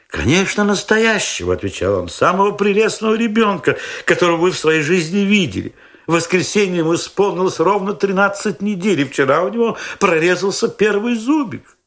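high, eager proud-father voice; fussy young father. A narrator's reading. Young father boasts to narrator about his baby, high and eager.

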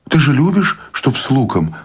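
high-pitched, wary question; tense suspicion. A narrator's reading. Suspicious wife close to tears, tense questioning. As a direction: question him anxiously, starting high. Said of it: "напряглась она, снова заподозрив что-то".

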